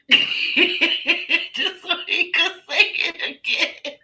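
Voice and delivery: high, slow words broken up by laughing; squealing through real laughter; helpless laughter. Real, not acted. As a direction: force it out while cracking up. Cracking up at her memory.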